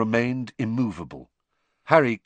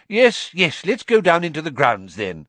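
higher, louder hurried brush-off; impatient; 8 semitones higher, 6 dB louder than usual. A narrator's reading. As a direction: rush everyone out. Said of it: "said Cornelius impatiently".

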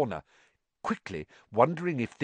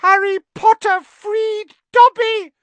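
very high, loud joyful squeal; shrill elf; 11 dB louder than usual. Acted. Freed house elf gazing up at Harry: shrill delight. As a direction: shriek with grateful joy. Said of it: "said the elf shrilly".

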